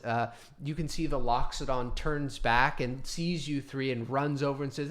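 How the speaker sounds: ordinary talk, nothing marked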